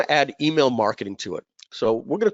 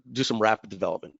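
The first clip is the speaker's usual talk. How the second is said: normal talking voice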